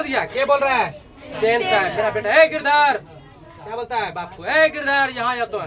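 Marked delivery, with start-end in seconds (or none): 2.3-3.0 higher, louder bossy summons; boss calling out. Acted. Boss summons his clerk. 4.4-5.6 boss calling out. Acted. Boss summons his clerk.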